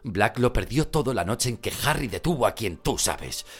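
tense hushed urgency; worried urgent. Acted. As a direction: press the point urgently. Mr. Weasley arguing with Molly.

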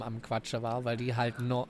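normal talking voice

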